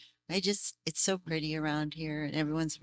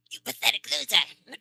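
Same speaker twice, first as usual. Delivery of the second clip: playful, higher villain sneer through a laugh; Frieza voice; 5 semitones higher, 6 dB louder than usual. Retold or imitated. Behind her husband's back, a mock Frieza sneer. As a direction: tease like the villain, half-laughing. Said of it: "I'll go Frieza on you".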